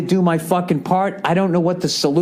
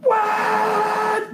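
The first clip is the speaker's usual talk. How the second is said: much higher blaring horn call; mock train horn shout; train horn imitation. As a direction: blast it like a locomotive horn. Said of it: "It just goes".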